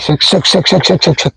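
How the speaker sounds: quick repeated hissy "sek" bursts; imitated noisy wingbeats. Shown as a demonstration. Showing how a bird cutting the wind sounds, imitated wingbeats. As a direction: mimic a noisily descending bird. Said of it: "Jadi suaranya justru keras".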